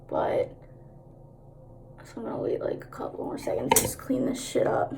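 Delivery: under-breath murmur; whispering. Real, not acted. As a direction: mutter it to yourself. Murmuring while working.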